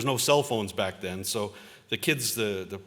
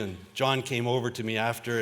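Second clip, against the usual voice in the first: usual speaking voice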